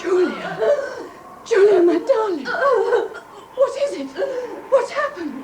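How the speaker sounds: high, loud panicked calling out with sobs; anguished high crying over her twin; frantic cry. Acted. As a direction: cry out in terror to her stricken sister. Sister finds her dying twin.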